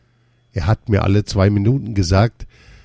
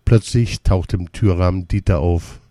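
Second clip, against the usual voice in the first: ordinary delivery, nothing marked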